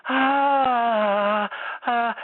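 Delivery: high, loud breathy sung ah; leaking voice straining for a high note; desperate leaky ah. Shown as a demonstration. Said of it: "I feel desperate".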